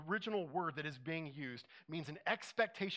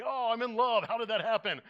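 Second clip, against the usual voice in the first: hammed-up, louder and higher gasp of surprise with a laugh; mock surprise; 5 semitones higher, 9 dB louder than usual. Preacher mocking the culture's idea of love, hammed-up surprise. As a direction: play the dazed lover who tripped into love.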